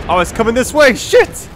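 alarmed, jumping to a high shout; startled yelp. Real, not acted. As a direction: panic as the light finds you. Searchlight swings toward him.

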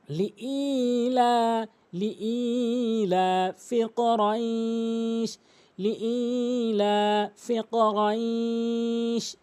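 very slow, sustained chant; drawn-out Quranic recitation; model recitation. Shown as a demonstration. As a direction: hold every long vowel in লিইলাফি কুরাইশ. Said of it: "এভাবে উচ্চারণ করবেন".

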